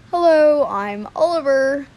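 lightly attempted boy character voice; loud hello in a not-quite-convincing character voice; Oliver. Shown as a demonstration. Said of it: "this one is Oliver's voice".

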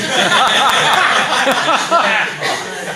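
bursting into a high laugh; real laugh. Real, not acted. Preacher laughing after joking with the church about bearing arms. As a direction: laugh at your own risky joke.

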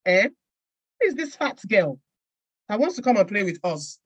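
mock playground disdain; rejecting children. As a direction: imitate children turning away a newcomer. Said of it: "the next thing I get is".

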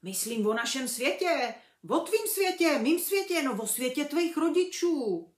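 big booming giant, loud and rough-hearted; hearty half-giant, loud and emphatic; Hagrid's voice. A narrator's reading. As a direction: speak as a huge, hearty gamekeeper. Hagrid explains to Harry.

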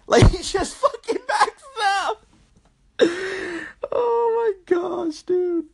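high, squeaky talk through laughter; helpless laughing. Real, not acted. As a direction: crack up mid-sentence, voice shooting up. Cracking up at own story.

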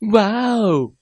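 startled high wow; scared cry. A narrator's reading. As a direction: gasp out in fright. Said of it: "莫娜害怕地叫出了声". (害怕地惊叫)

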